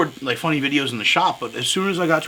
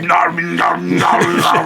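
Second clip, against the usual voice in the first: machine buzz imitation; 4 dB louder, much slower than usual. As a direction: growl like a coil tattoo machine. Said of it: "my other tattoo machine is like".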